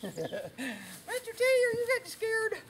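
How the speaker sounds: high teasing coo to the horse; teasing baby talk. Pretend. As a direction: tease him like a toddler.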